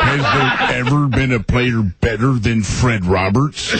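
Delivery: slow, dreamy Walton voice; Bill Walton impression. Impressionist doing Bill Walton for the hosts, slow and dreamy. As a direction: drift off like a spaced-out Walton. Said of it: "like Bill Walton".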